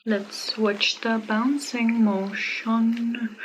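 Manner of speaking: slow announcement. Shown as a demonstration. Announcing the bounce demo.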